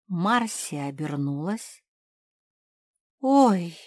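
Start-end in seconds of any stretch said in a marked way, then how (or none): 3.2-3.8 mild high, loud yelp; startled squeal. A narrator's reading. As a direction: squeal a little in surprise. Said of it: "взвизгнула Зефирка". (испуганный взвизг)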